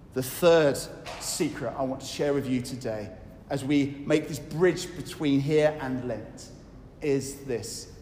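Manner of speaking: normal talking voice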